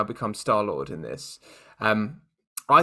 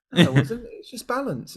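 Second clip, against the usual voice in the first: mock defensive; 10 semitones higher than usual. Thanos defending himself, acted out.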